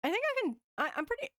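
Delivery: ordinary delivery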